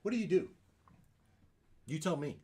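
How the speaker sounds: usual speaking voice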